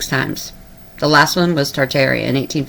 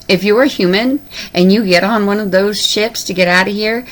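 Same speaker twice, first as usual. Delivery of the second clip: ordinary delivery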